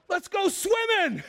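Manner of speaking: high, slow, gleeful call; excited call. Retold or imitated. Youth pastor retelling kids yelling to swim, high and gleeful. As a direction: shout it like a thrilled kid about to jump in.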